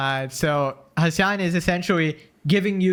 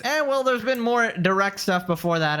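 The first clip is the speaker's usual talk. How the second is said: ordinary talk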